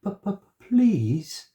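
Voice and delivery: put-on high, stammering little girl; frightened stammer. A narrator's reading. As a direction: stutter it out in fright. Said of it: "she said".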